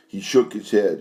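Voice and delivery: ordinary delivery, nothing marked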